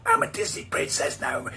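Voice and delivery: high, breathy whisper of the princess voice. Acted. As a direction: hiss it like a secret threat.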